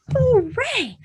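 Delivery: high loud cheer; shout. Shown as a demonstration. Kids' song cheer, happy shout. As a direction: cheer it out big. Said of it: "Give a shout".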